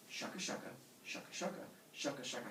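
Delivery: soft, whispery rhythmic rubbing sound; stick-rubbing noise. Acted. God rubs two sticks for fire, soft chant. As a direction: make the sticks rasp softly in rhythm.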